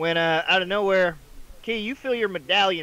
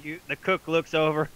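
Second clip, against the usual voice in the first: ordinary talk, nothing marked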